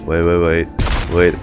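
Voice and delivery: ordinary delivery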